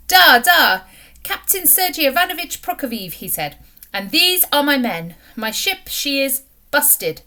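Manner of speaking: put-on a cheerful foreign skipper reassuring the children; Russian captain. A narrator's reading. Captain introduces himself.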